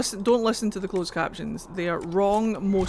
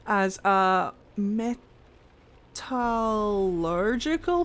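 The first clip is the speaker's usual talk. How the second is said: very slow, stretched-out "metallurgical"; sounding it out; much slower than usual. Real, not acted. Streamer reading a definition of borax aloud, sounding it out. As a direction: work through "metallurgical" syllable by syllable.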